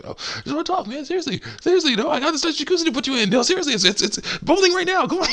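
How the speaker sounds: fast, very high falsetto sales pitch; see-through coaxing in a squeaky voice; coaxing walrus cook. Retold or imitated. Walrus luring Crash into the pot, coaxing falsetto. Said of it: "you totally know what he really wants".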